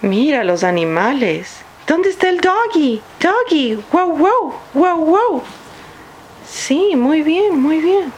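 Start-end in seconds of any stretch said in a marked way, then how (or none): 1.8-3.8 put-on reading to a toddler. Asks toddler where the dog is. 3.9-5.4 high playful woof woof; dog bark. Acted. Mother barks for picture dog. 6.5-8.1 put-on praising a toddler.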